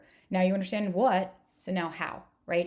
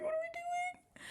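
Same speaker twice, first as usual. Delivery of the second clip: squeaky mock wail, slow and softer; 9 dB quieter, slower than usual. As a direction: whine it way up like a fake cry. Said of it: "And I'm like".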